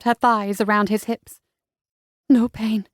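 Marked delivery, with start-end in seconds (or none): 2.3-2.9 lighter female reply, falling tune. A narrator's reading. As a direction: her answer, brighter and assured.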